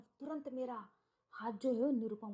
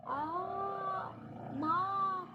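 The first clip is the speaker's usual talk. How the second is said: a dying shriek, retold; stabbed scream; 8 semitones higher, much slower than usual. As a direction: scream out for her mother as the knife goes in. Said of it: "करके जोर से चिल्लाने लगी".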